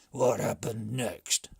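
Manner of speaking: loud, slow grumbling chief; the brigand chief, fed up; slow loud complaint from the chief; gruff chief. A narrator's reading. Chief demanding more.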